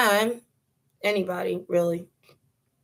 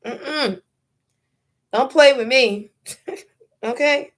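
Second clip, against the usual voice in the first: louder, punchy warning on play; firm warning; 4 semitones higher, 5 dB louder than usual. Real, not acted. Vlogger warning anyone who'd touch her, firm and loud. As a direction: say it like a real threat, don't play with me. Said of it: "warning anyone who touches her".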